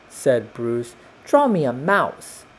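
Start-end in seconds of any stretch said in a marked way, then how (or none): 1.2-2.4 higher-pitched storybook cat; lightly voiced cat making a demand; meowing cat. A narrator's reading. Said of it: "meowed Mildred".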